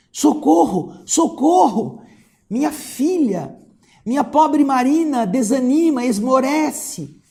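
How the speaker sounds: high, loud cry for help; anguished shout. A narrator's reading. Mother pleading for her failing daughter, shouting. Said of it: "gritou sem preâmbulos".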